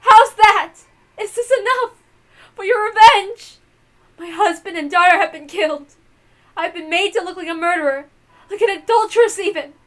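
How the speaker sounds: shrieking, bitter challenge; hysterical taunting yell. Acted. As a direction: fling it at her tormentor half-mad. Taunting the avenger, near hysteria.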